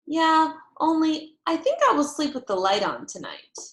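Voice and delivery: put-on a stubborn child bargaining to keep the light on; insistent little girl.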